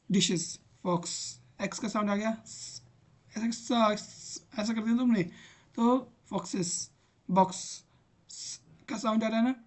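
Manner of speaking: normal talking voice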